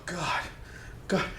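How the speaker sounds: normal talking voice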